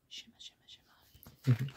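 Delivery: slow, sparkly whisper. Pretend. Reader makes the pool twinkle, whispering. Sound effect for the picture.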